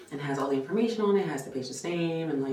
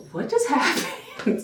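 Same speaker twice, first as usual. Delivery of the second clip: high, slow, laughing bewilderment; laughing disbelief; 11 semitones higher, 5 dB louder, slower than usual. Real, not acted. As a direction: laugh it out, stunned. Stunned after tech storms out.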